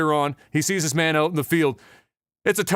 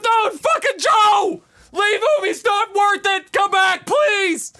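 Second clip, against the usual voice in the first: high, loud pleading yell; desperate shouting; 4 dB louder, much slower than usual. Acted. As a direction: beg him back from afar. Platoon leader begs him back.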